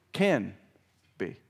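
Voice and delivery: Can, pause, be, each word weighted; slow stressed can be. Shown as a demonstration. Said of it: "Can be, not will be".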